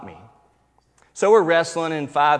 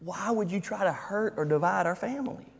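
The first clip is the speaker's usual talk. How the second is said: a hint of a little girl; 5 semitones higher, 7 dB quieter than usual. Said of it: "she was thinking".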